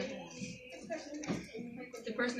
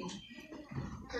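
ordinary talk

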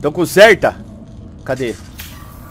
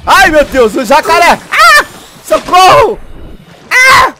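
high shrieks of panic; terrified screaming; 14 semitones higher, 10 dB louder, slower than usual. Real, not acted. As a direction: scream at the attacking gator. Alligator attack. (gritos de terror)

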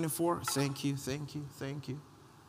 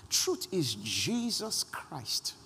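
slow, weighty statement; preacher driving home his main point; emphatic declaration; much slower than usual. Real, not acted. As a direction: land every word slowly.

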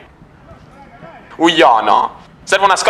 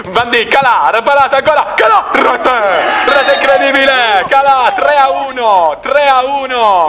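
breathless italian goal commentary; 5 semitones higher than usual. Real, not acted. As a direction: shout the goal, stretching rete. Goal call, live commentary.

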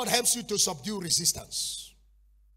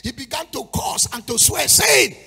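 1 rising preaching shout; 6 semitones higher, 13 dB louder than usual. Real, not acted.

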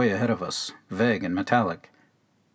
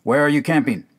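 put-on sharp, louder demand from a suspicious old man; angry question; 5 semitones higher, 6 dB louder, faster than usual. A narrator's reading. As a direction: snap the question at the strangers. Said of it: "said angrily".

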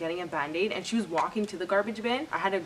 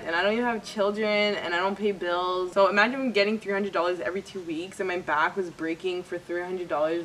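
ordinary talk

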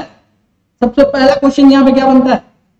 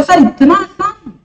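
student voice; 3 semitones higher than usual. Retold or imitated. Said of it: "बोले".

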